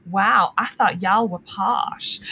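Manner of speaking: slow, drawn-out mock Texan drawl; Texan accent put on for a funny retelling; imitated Texan accent. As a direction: imitate a Texan cousin, slowly. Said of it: "some of my cousins live in Texas".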